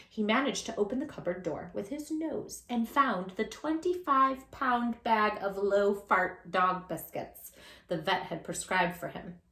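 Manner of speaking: ordinary delivery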